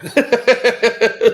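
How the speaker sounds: short high burst of laughter; high, loud laughing burst. Real, not acted. Laughing at the story.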